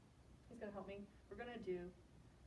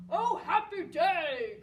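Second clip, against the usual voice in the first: joyful loud cry, high and drawn out; Lou; 5 semitones higher, 10 dB louder, slower than usual. A narrator's reading. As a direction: cry it out with delight. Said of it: "cried Lou".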